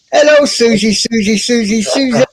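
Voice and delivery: high teasing welcome to a friend; sing-song greeting. Real, not acted. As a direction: call her name out like a playful chant. Greeting Susie joining the stream.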